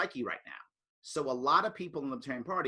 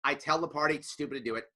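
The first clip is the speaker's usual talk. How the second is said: ordinary delivery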